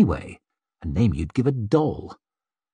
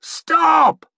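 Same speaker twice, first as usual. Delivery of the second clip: high-pitched, forceful shout; roar; 4 dB louder than usual. A narrator's reading. Curbman to men attacking the maid, roaring. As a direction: roar it to halt a mob. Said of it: "roared Curbman".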